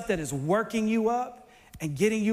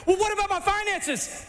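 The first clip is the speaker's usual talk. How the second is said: high anxious whine; 9 semitones higher than usual. Retold or imitated. Worried believer pleads with God.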